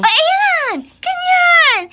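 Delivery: loud high-pitched gloating; shrill triumph. Acted. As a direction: crow over winning her friend. Snob triumphant at friend. (malakas at matinis na pagmamayabang)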